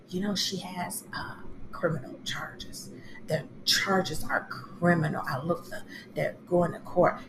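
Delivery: hushed, gossipy whisper. Retold or imitated. Retelling her whispering about criminal charges, softly. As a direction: lean in and whisper the scandal. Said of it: "And they start whispering".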